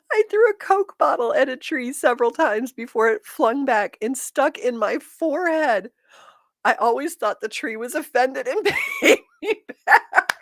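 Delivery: laughing re-read. Real, not acted. Re-reading the funny comment aloud, laughing. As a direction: push through the giggles to finish it.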